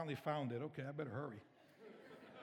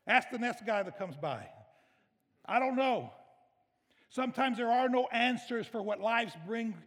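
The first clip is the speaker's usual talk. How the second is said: loud, raised, driving sermon voice; impassioned preaching; 6 semitones higher, 7 dB louder than usual. Acted. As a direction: preach with full force to the congregation. Preaches at full force.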